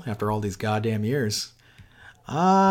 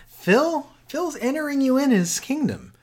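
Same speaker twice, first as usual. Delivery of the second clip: high mock voice; 12 semitones higher, slower than usual. Impersonating Phil pitch.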